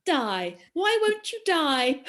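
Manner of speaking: loud, slow theatrical plea rising high; hammed-up despair at a stubborn plant; mock exasperated cry. Quizmaster acting out the riddle, mock exasperation.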